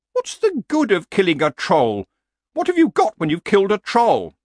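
high horse voice, arguing; objecting horse. Acted. Horse questions killing a troll, high and argumentative. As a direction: challenge him, pitched well up.

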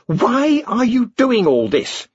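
high, slow, demanding queen. A narrator's reading. Queen demanding answers from the wizards, high and slow. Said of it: "the queen demanded".

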